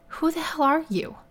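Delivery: startled, indignant woman; indignant challenge. Acted. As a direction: challenge a stranger, pitch jumping up. Startled woman challenges intruder in story.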